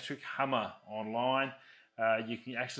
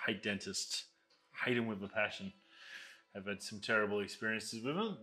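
ordinary talk

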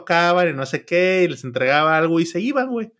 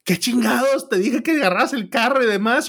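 scolding friend; 7 semitones higher than usual. Retold or imitated. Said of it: "me dio la cajetada de mi vida".

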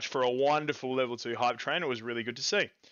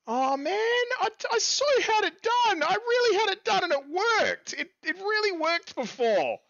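high, whiny, exasperated moan; frustrated whine; 3 dB louder than usual. Real, not acted. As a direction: whine that it worked before. Emotes failed, frustrated.

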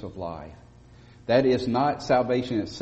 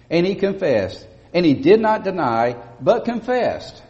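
higher, louder emphatic scripture reading; 7 semitones higher, 6 dB louder than usual. Shown as a demonstration. Preacher stressing John's confession to the congregation, loud and high. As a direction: hammer home every word of the verse. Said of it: "John is emphatically saying".

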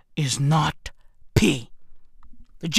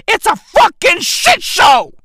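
loud high rant; exasperated shout; ranting outburst; 8 semitones higher, 6 dB louder than usual. Real, not acted. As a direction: blurt it out at full volume.

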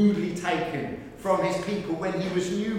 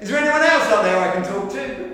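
desperate punchline; 4 semitones higher, 6 dB louder, faster than usual. Retold or imitated. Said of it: "and says".

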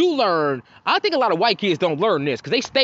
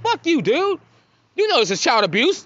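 mock angry kid; 8 semitones higher than usual. Kid angry at laughing bystander.

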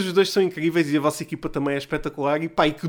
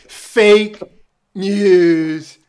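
loud, punchy Trump voice; Donald Trump impression; 8 dB louder than usual. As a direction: spit out fake news like Trump. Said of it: "Donald Trump".

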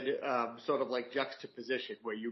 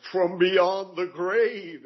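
very slow, louder, higher hammed-up ghostly drawl through a laugh; silly spooky voice; 6 semitones higher, 7 dB louder, much slower than usual. Shown as a demonstration. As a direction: ham it up like a goofy horror host. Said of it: "if they wanted to make it a little bit silly".

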